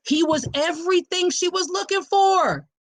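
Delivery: high, sustained proclaiming pitch; emphatic declaration. Real, not acted. Host driving home what he was to her, emphatic. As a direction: proclaim it high, falling at the end.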